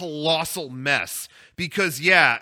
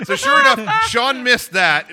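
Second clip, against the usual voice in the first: excited; 5 semitones higher, 6 dB louder than usual. Real, not acted. Burst of laughter, raised voice.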